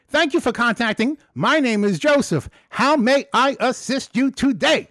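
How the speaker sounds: high, loud bright phone-agent greeting; loud high customer service voice; call center greeting. Retold or imitated. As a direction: chirp it like an eager agent. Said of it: "I start off with".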